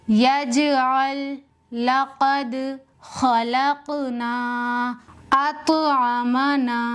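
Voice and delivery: each word drawn out slowly with clear qalqala bounce; धीमी, साफ़ कलकला के साथ तिलावत; slow Quranic recitation. Shown as a demonstration. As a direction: recite slowly, letting the stop letters rebound. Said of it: "ध्यान से सुनना मैं किस तरह से पढ़ रही हूँ".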